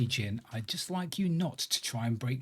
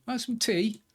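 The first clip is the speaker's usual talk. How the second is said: villager offering tea; 8 semitones higher, slower than usual. Pretend.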